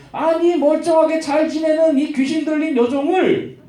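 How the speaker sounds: high-pitched, indignant complaint, acted out; angry protest. Owner complains about losing his money-maker, angry protest. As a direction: protest it, stretching the 왜. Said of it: "화가 났어".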